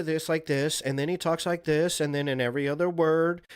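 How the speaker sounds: high upspeak, each phrase lifting like a question; mock upspeak, pitch climbing at every pause. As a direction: imitate a YouTuber's rising phrase endings. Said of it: "kind of upspeak".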